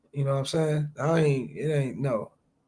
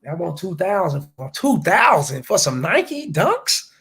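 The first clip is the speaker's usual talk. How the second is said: incredulous; 3 semitones higher, 4 dB louder than usual. Retold or imitated.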